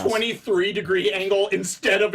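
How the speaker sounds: loud, high, fussy ranting; mock outrage. Picky moviegoer ranting about his seat, mock outrage. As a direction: complain as if gravely wronged.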